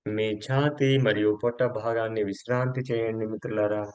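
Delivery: slow, measured relaxation voice with long pauses; guided yoga nidra. Acted. Yoga teacher guides friends to relax each body part, slow guided meditation. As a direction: let each instruction stretch out and settle.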